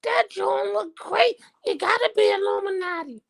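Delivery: high, gushing mock fan voice; gossiping conspiracy fan. Mocking conspiracy fans online with a high, gushing voice. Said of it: "they can say".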